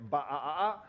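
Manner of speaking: rising sheep bleat; sheep baa. Pretend. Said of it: "we're gonna say".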